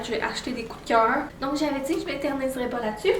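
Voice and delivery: fast wired chatter; too much coffee. Real, not acted. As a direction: rattle it off fast, buzzing on coffee. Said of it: "On dirait que j'ai trop bu de café un matin".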